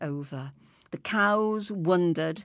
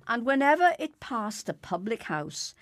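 ordinary delivery, nothing marked

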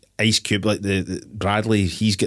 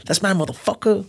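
high-pitched movie tough-guy impression; mock gangster claim; 11 semitones higher than usual. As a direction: stake your claim in a put-on high voice. Voicing film gangster claiming the gold.

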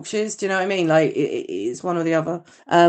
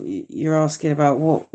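ordinary delivery

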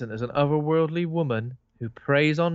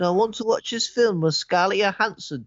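put-on jokey northern English accent, pitched up; mock northern English lad, higher and cheeky; 8 semitones higher, 3 dB louder than usual. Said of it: "I'm doing a northern accent".

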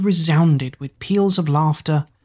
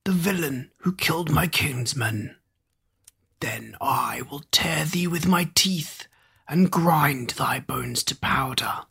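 giant's threat; 6 dB quieter than usual. A narrator's reading. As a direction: breathe the threat like a hidden giant. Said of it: "cried the giant".

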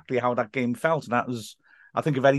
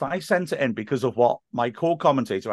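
normal talking voice

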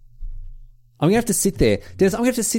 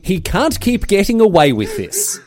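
mock Jesse Pinkman; 6 semitones higher, 3 dB louder, slower than usual. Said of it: "Jesse Pinkman in tears going".

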